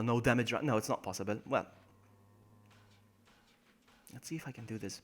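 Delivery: usual speaking voice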